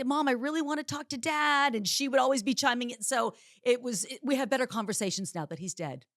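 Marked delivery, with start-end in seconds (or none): 0.0-1.7 exasperated. Retold or imitated. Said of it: "and I'd say".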